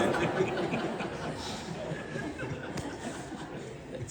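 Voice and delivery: fading breathy laughter; long soft laugh. Real, not acted. Monk keeps laughing softly after the punchline. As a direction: let the laugh trail off quietly.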